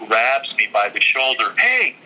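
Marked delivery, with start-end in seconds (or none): 1.6-1.9 stranger calling out. Retold or imitated. Stranger grabs him from behind.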